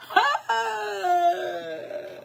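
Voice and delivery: shrill laugh that cracks into a shout; high shrieking laugh. Real, not acted. Vlogger shrieking with laughter after naming the charm.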